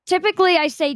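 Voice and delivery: normal talking voice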